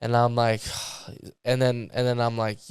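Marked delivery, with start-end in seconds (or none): 0.6-1.4 soft, voiceless sigh. Retold or imitated. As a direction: let out a quiet acted breath. Retold weary reaction to rant.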